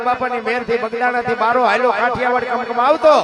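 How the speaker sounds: chanted verse building from steady to loud and high; folk poem recitation. Acted. Storyteller reciting the quake poem to the dayro crowd, rising chant.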